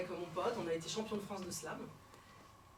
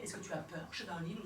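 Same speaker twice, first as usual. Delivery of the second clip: murmured; 4 semitones lower, 7 dB quieter than usual. Acted. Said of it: "il murmure".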